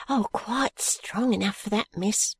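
put-on a young maid glad to be trusted; eager girl's cry. Maid answering her mistress gladly, girlish voice. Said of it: "cried Charley".

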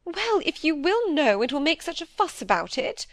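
high, exasperated giving-in; driven to extremity. A narrator's reading. Cornered wife yields to questioning, driven to extremity. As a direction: give in under pressure, pitched high. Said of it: "driven to extremity".

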